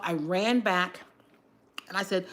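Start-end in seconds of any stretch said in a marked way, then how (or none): none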